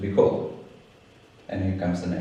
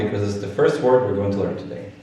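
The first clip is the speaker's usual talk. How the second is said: ordinary delivery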